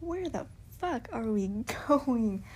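laughs through the question; half-amused, half-alarmed; laughing confusion. Retold or imitated. To the guy while lost in the woods, laughing.

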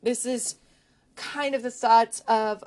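ordinary talk

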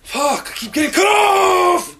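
yelling out loud, half-crying in frustration; frustrated outburst. Real, not acted. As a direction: burst out, fed up with being cut off. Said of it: "Jesus Christ".

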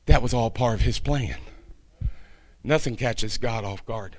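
soft, low and unhurried between pauses; subdued, reflective preacher; hushed reflection. Real, not acted. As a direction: quietly let it sink in. Hushed reflection after sermon's climax.

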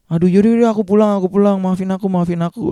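high, flustered, repeated apologies; retold frantic apologising, pitched well up; guilty apology. Narrator to Niken from the road, flustered guilty apology. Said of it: "gua udah nggak enak banget".

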